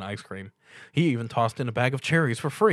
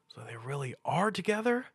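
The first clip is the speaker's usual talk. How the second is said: whispered aside; 5 semitones higher than usual. A narrator's reading. Stuart whispering in wonder at the ponies. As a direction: hush it to himself.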